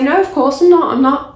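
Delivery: defensive denial. Retold or imitated. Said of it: "And I'd say".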